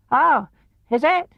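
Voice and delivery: very high, timid squeak; small frightened piglet; nervous Piglet. A narrator's reading.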